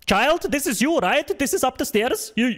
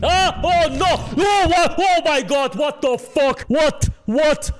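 shocked outcry; 6 semitones higher, slower than usual. Real, not acted. Gamer reacting to a shock on screen, high shocked cries. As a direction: cry out in disbelief, pitched up.

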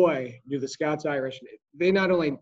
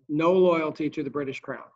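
ordinary talk, nothing marked

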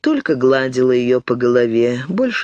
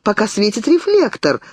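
stubborn fear; 7 semitones higher than usual. A narrator's reading. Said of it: "упорствовала младшая сестра".

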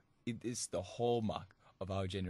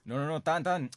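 louder, higher protest, half-acted; mock disbelieving caller; 6 semitones higher, 6 dB louder than usual. Wrong-number caller refusing to believe the prank, mock loud protest. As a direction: insist he's only joking, louder. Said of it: "he'll be like".

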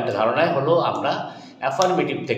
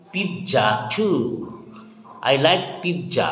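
usual speaking voice

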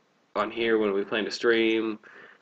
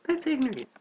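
mock voice; 3 dB quieter than usual. Mocking repeat of co-host.

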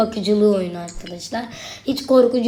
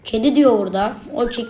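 ordinary talk, nothing marked

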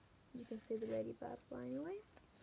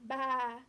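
loud sheep baa; 8 dB louder than usual. A narrator's reading. Hen imitates a sheep.

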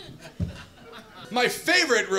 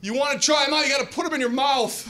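joking stranger; 7 semitones higher, 3 dB louder than usual. Retold or imitated.

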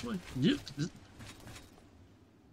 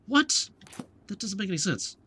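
sudden high, rising yelp; baffled disbelief; 7 semitones higher than usual. Real, not acted. Player expected nighttime but got morning, incredulous.

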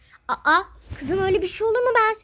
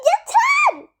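shrill shriek, much higher and louder; scream; 8 dB louder than usual. Acted. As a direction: scream to stop the fight. Child screams at parents. (çığlık atarak)

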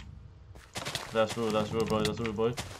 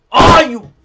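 very loud, high-pitched yell; startled shout; 20 dB louder than usual. Real, not acted. Player suddenly caught out in game, startled shout. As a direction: burst out at full volume.

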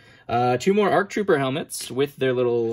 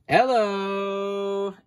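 delighted; 7 semitones higher than usual. Real, not acted. Greeting a prized figure.

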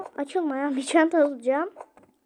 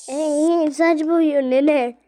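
put-on very slow, louder old granny voice; grandma voice; 3 semitones higher, 10 dB louder, much slower than usual. Grandma doll about hair dye, slow granny voice.

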